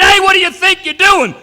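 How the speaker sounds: loud, high, shouted alarm; shocked barfly yelling at the intruder; startled shout. Retold or imitated. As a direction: yell it at the bloke behind you. Barman catches him behind.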